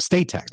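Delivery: "State" pitched high; stress on STATE. Real, not acted. Host clarifying state not federal tax, stress on "State".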